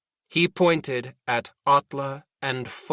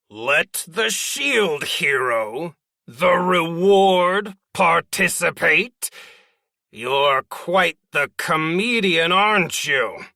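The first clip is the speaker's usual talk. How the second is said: slow, mocking, louder sarcasm; sarcastic; 4 semitones higher, 6 dB louder, slower than usual. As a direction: drip sarcasm over every word. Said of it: "Jaralise said sarcastically".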